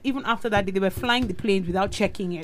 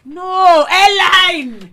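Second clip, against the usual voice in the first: high, much louder protest; loud exasperated correction; 10 semitones higher, 11 dB louder, much slower than usual. Real, not acted. Co-host corrected on the donut airline question, loud exasperation. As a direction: cry it out to put them right. Loudly correcting co-host's wrong guess.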